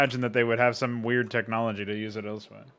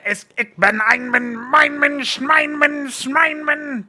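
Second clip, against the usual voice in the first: high, loud mock-German shouting; Hitler impression; 13 semitones higher, 7 dB louder, slower than usual. Shown as a demonstration. Streamer showing the most German he can do, shouted Hitler impression. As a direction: shout it as a ranting caricature of German. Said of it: "just like a Hitler impression".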